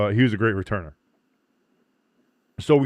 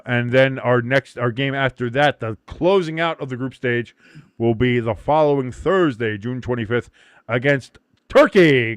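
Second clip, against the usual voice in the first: normal talking voice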